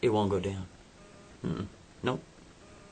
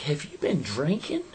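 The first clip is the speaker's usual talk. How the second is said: put-on suspicious girlfriend; 6 semitones higher than usual. She suspects he's drunk.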